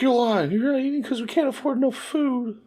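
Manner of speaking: very high mock child voice; accusing kid, imitated. Child calls out mother's diet excuse, high mock-accusing voice. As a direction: squeal an accusation like an upset kid.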